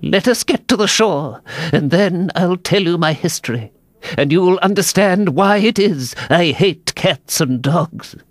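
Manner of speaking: small, quavering mouse voice; trembling. A narrator's reading. The mouse promises Alice its history, trembling creature voice. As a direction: speak with a tremble, as a shaken little mouse. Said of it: "it said in a low, trembling voice".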